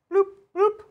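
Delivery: high-pitched little bloops; playful bloop noises. Pretend. Player removing killed models, playful bloops. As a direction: pop out two squeaky bloops.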